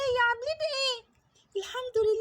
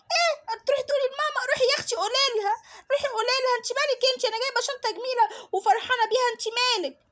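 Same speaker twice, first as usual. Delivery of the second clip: put-on defiant bully girl. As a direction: sneer it like a spoiled kid.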